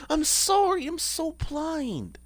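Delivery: very high, slow, fading whine; whimpered apology. Real, not acted. Streamer apologising for an oversight, high fading whimper. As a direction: whine it high and let it die away.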